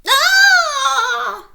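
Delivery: very high, loud shriek; terrified old lady scream; granny scream. A narrator's reading. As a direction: scream in shock. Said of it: "yelled Granny".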